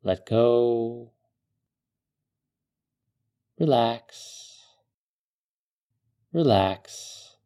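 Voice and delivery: calm meditative tone. Acted. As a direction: ease the listener into calm. Leads a guided desert meditation.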